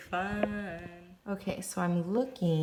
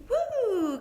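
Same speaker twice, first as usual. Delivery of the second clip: high bright whoop of excitement; excited cheer. Real, not acted. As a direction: cheer like the big day is here. Cheering on filming day morning.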